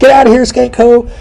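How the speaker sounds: high falsetto order to get out; mock angry confrontation. Acted quarrel from the movie plot.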